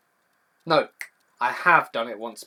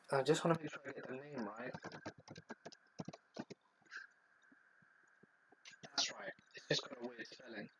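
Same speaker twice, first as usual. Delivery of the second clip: much softer, half under the breath; talking to self; 15 dB quieter than usual. Real, not acted. Streamer checking a Pokemon name's spelling, quiet aside to self. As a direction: murmur it while checking something.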